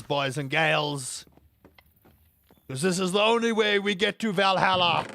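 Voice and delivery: slow, drawn-out stage announcer voice, loud at the start; milk it like a rock star hyping the crowd; hammed-up concert frontman. Singer greeting imagined concert fans, hammed-up and slow. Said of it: "Can you imagine me at a concert?".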